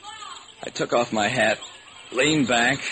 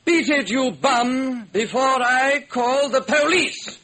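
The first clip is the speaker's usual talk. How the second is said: high, slow haughty servant; snooty valet; slower than usual. Acted. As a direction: sneer at the bum, slow. Valet chases off bum.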